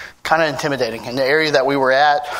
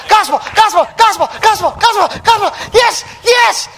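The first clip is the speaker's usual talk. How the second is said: much higher, loud, shouted calls; excited gospel run; 12 semitones higher, 3 dB louder than usual. Pretend. Pastor handing out the gospel like pancakes, shouting with excitement. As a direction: shout it out like a thrilled game. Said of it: "Imagine if we said".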